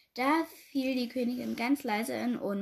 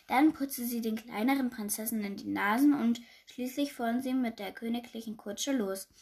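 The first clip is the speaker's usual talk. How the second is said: ordinary delivery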